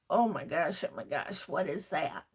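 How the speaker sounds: low, hushed mock dismay; imitating a puzzled group, deep and quiet; mock bewilderment. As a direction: drop the voice way down as if baffled. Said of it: "We're all like".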